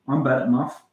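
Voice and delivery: put-on Scottish lilt; Scottish accent. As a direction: say it like a Scot. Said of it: "Scottish accent".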